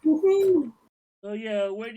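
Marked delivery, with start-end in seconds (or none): 0.0-0.7 loud, high whoop of joy; excited cheer. Real, not acted. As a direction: whoop it out like a win. Cheering as work gets done.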